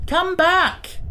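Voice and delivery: high, loud call into the sky; shouting. A narrator's reading. Tip calling the circling Gump home, loud shout. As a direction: shout it after something flying off. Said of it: "shouted the boy again".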